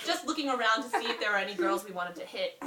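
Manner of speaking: ordinary talk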